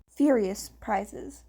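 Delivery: plain reading voice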